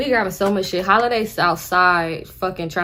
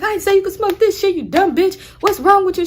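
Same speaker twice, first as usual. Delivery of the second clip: angry yell; 11 semitones higher, 5 dB louder than usual. Retold or imitated. Nephew yells at aunt.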